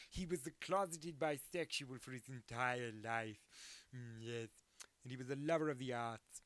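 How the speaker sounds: a light hammed-up old horror actor, savoring each word; Vincent Price voice. Describing the horror actor while slipping into his theatrical voice. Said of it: "Vincent Price had a very sort of".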